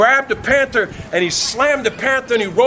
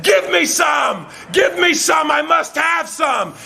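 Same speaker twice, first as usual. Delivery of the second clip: high, insistent fierce cry; hungry, ringing challenge; shouted demand; 6 semitones higher than usual. Acted. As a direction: demand it from the whole arena. Beasts demand violence from crowd.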